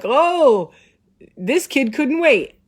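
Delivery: high, exclaiming mock-doctor voice; jovial doctor impression. Acts out doctor's jovial entrance.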